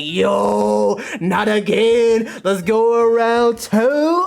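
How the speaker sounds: hammed-up yo not again; mock exasperated protest. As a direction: stretch it out like a pleading groan. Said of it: "I'm like".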